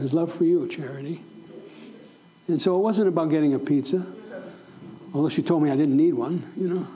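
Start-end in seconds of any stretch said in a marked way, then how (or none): 0.7-1.2 tender. Retold or imitated. Tenderly addressing the pizza girl.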